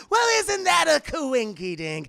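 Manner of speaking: loud, high, shouted villain glee; theatrical high-pitched villain crowing; Joker. Acted. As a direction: shout it gleefully like a hammy villain. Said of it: "the Joker slams down the phone".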